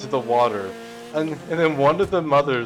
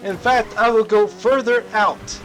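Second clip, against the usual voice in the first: high, loud refusal; defiant yell; 10 semitones higher, 4 dB louder than usual. Retold or imitated. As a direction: shout the ultimatum from the sea. Defiant shout from the water.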